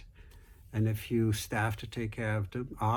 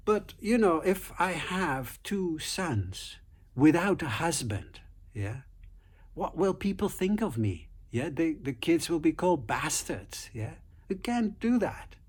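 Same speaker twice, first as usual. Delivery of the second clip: a hint of a scheming wife, pitched higher; lightly voiced sly wife pressing her point, higher; cunning wife; 6 semitones higher than usual. Cunning wife argues with snake.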